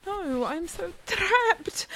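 normal talking voice

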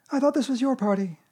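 high, squeaky little piglet. Acted. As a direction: pipe up in a tiny animal voice. Piglet talks to Pooh.

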